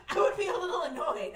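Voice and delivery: loud words pushed out through a laugh. Real, not acted. To viewers, laughing at her own annoyance. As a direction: admit it while cracking up.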